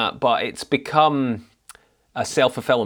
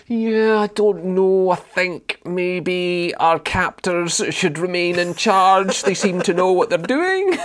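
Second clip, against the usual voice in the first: drawn-out, higher mocking dither; mock hesitant; 3 semitones higher, slower than usual. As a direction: impersonate a timid, deferential voter, hammed up. Mocking a hesitant nation's answer.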